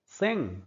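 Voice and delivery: सेंग with no g sound; silent g. Shown as a demonstration. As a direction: sing without the g. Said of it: "ग silent होता है". (सेंग, ग silent)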